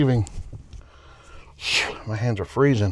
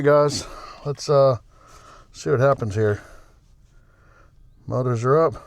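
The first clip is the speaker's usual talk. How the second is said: ordinary talk, nothing marked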